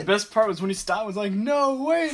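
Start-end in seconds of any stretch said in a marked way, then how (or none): 1.3-2.1 much higher imitation of a desperate plea; mock panicked cry. Storyteller replaying the runner's plea, mock panicked cry. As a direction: replay the man's cry in a high voice. Retelling the man's last cry.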